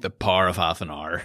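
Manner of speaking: Belfast "par of haf an ar" for "Power of Half an Hour"; "Power of Half an Hour" squashed in a Belfast accent. Shown as a demonstration. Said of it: "If you're from Belfast, it's just like, it sounds so deadly".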